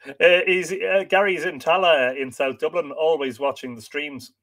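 normal talking voice

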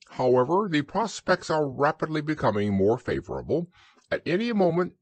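high, wordy owl forecasting; pompous Owl. A narrator's reading. Owl giving Christopher Robin a flood forecast, pompous.

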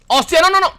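high, loud startled cry; startled shout. Real, not acted. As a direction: yelp in sudden alarm. Worms attack suddenly.

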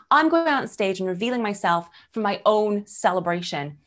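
ordinary delivery

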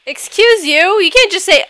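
high-pitched indignant retort; affronted. Real, not acted. Player snubbed by would-be roommate, high affronted retort. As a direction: take offense, pitch jumping up. Offended by a curt no.

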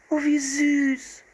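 put-on high, slow, delighted girl's call; delighted cry. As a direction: squeal with delight as Anne. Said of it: "rief sie".

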